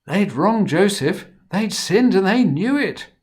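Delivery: high, loud alarmed telling; fearful. A narrator's reading. Narrator to children, the brothers' fear, high and loud. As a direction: sound the brothers' panic.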